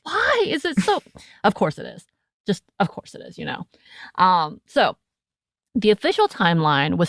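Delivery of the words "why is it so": much higher, mock-baffled whine; exasperated self. Host retelling her reaction, high and exasperated. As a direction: throw up your hands in mock disbelief. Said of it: "I'm like".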